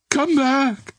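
high, drawn-out wail. A narrator's reading. Girl calling back the man she thinks dead, wailing. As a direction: wail it out in anguish. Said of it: "she wailed".

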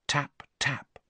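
whispered, soft tap tap. A narrator's reading. Narrator whispering the soft tapping at the window.

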